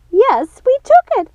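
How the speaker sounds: high shrieking confession; shrill sister screaming yes; screamed. A narrator's reading. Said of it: "screamed Martha".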